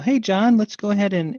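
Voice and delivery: sudden loud hail across the call. Real, not acted. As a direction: raise the voice to grab his attention. Host calls out to participant.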